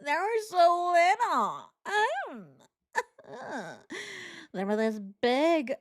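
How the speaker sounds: high, whimpering mock tears; hammed-up weepy lament with fake sobs; fake crying. Commentator parodies her crying about herself, badly faked tears. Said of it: "It made it worse that she went".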